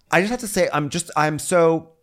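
high, halting attempt at sincerity; awkward apology. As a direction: imitate someone choked by politeness. Mimicking guests struggling to apologize.

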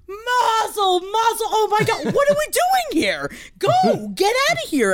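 a high, half-sobbing cheer of congratulations; overjoyed. Retold or imitated. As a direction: burst out in happy tears. Actor overjoyed at coming baby.

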